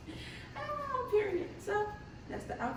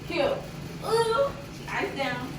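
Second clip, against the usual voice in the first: excited; 8 semitones higher, 6 dB louder, slower than usual. Real, not acted. Admiring her own look.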